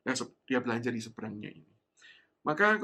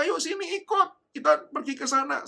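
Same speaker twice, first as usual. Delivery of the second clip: coaxing stranger; 13 semitones higher, 7 dB louder than usual. Retold or imitated. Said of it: "Orang-orang di sekitar mulai berkata".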